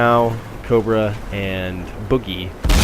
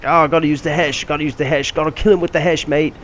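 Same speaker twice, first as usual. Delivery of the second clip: higher, hammed-up British teammate voice; mock British accent; 6 semitones higher than usual. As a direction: imitate a stubborn British mate in a mock British accent. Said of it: "Diz is British, by the way".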